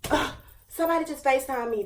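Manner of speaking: usual speaking voice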